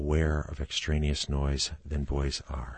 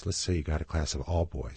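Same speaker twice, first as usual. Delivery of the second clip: usual speaking voice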